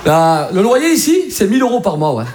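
name the rent like a Marseille local; casual Marseille accent, stretched opening; Marseille landlord. Acted. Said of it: "un mec à Marseille te loue un dix mètres carrés".

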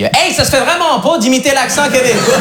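put-on high, slow Quebec-accent scolding. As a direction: drawl it up like a Montreal buddy, Quebec accent. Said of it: "il me l'a pas dit comme ça". (accent québécois traînant et aigu)

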